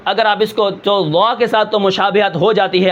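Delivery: ordinary talk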